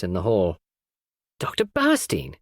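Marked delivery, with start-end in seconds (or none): 1.4-2.4 a sharp high cry of surprise; exclaimed. A narrator's reading. Cynthia spotting an unwelcome visitor, high surprised exclamation. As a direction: blurt the name out, much higher. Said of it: "exclaimed Cynthia".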